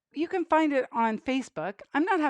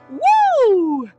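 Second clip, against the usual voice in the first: high excited whoop; excited woo; 11 semitones higher, 10 dB louder than usual. Acted. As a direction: whoop on the jump. Jump cue in song.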